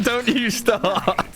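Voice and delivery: laughing while saying it; slow, laughing tease. Real, not acted.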